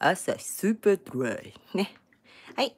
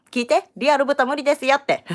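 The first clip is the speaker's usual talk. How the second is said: higher, louder insistence; emphatic announcement; 8 semitones higher, 6 dB louder than usual. Real, not acted. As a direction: make viewers listen, loud and high. Emphatic plea to viewers, later repeated louder. (高く強めに念押し)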